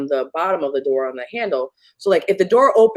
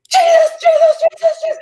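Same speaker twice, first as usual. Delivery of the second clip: shrill terrified shout, much higher; panicked screaming; 8 dB louder than usual. Retold or imitated. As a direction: scream the name for protection. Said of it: "It started screaming".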